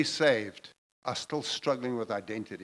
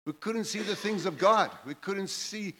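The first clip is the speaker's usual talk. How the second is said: raised, higher-pitched, impassioned preaching; preaching at full force; 4 semitones higher than usual. Real, not acted. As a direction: preach it out, building up.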